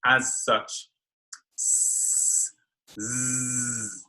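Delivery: soft voiceless "S", then voiced "z"; clear S and Z. Shown as a demonstration. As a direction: hiss "S" then buzz "z" from the front. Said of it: "it comes out of the front".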